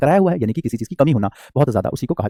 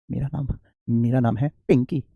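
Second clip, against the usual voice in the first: much softer and slow, barely there; imitating a bashful bride-to-be murmuring her name; shy soft reply; 2 semitones lower, 7 dB quieter, slower than usual. Teacher imitating a shy girl answering a suitor, softly and slowly. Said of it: "तो वो बड़ी धीरे से कहती है".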